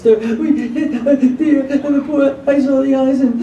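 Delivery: high, distraught retelling of the wife; imitating a wife in hysterics over the deer, up high; hysterical wife. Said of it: "my wife is crying hysterically".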